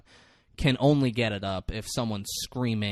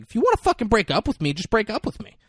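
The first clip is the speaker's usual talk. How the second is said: high, fast, louder furious yell at him; angry yell; 11 semitones higher, 5 dB louder, faster than usual. Retold or imitated. Beth yells at her boyfriend to break up, furious. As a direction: snap at him, higher and faster.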